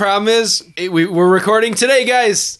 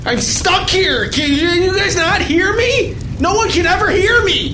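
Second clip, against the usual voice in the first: panicked shouting; 9 semitones higher than usual. Acted. Frantic panic scene.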